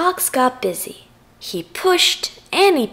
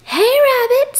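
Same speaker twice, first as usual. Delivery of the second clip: high, loud call from outside; yelled call; 12 semitones higher, 6 dB louder than usual. A narrator's reading. Stranger calling at the fox's door, yelled. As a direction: call out loudly through a door. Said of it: "someone yelled outside".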